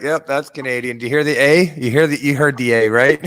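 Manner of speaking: put-on teasing fan spotting a Canadian accent; mock viewer voice. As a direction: play the knowing viewer catching the accent. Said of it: "they're like".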